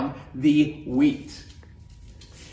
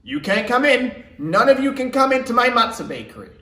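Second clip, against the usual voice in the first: loud, high mock guard; gruff guard voice; 6 semitones higher, 5 dB louder than usual. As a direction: play a bossy doorman blocking the way. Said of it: "a man standing at the front of the matzah bakery saying".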